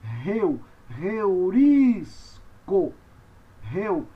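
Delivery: "reurisco" drawn out syllable by syllable; breathy English-h start on "reurisco"; slow model for learners; pronunciation demo. Shown as a demonstration. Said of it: "você pronuncia então reu".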